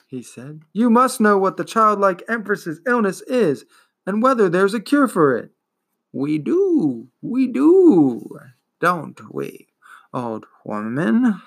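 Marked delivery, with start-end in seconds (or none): 0.7-5.5 put-on boy hero challenging the turtle, urgent. Continues challenge. 6.1-11.4 slow, dragging, wheezing ancient voice; wheezing old turtle. Acted. Turtle answers.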